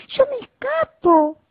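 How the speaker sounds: chillido muy agudo de ratón en fuga; chíllalo huyendo a todo correr; ratón que huye. Acted. (el ratoncito escapa del gato, chillido agudo)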